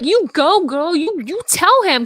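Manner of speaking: high, emphatic encouragement; cheering her on. Acted. Cheering the character on.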